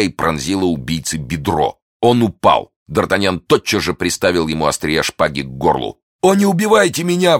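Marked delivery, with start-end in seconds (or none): 6.2-7.4 high, frightened plea for mercy; high pleading cry; pleading exclamation. Acted. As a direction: beg for your life, voice jumping up. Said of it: "воскликнул разбойник".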